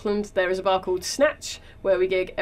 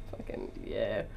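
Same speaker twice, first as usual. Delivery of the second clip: whispered gangster; 14 semitones lower, 14 dB quieter, slower than usual. Retold or imitated. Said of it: "gets pulled over by a cop and goes".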